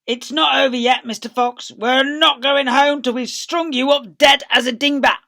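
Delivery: loud, high menace; taunting yell. Acted. Bean shouting threats down the fox's hole. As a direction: holler the threat down.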